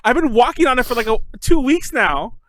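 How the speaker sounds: high-pitched exasperated disbelief; acted-out indignant objection; retold incredulous protest. Retold protest to doctor's call.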